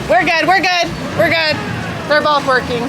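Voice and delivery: loud, high relieved shout; yelling across the room. Real, not acted. Relief after engine scare.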